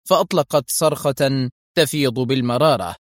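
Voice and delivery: ordinary delivery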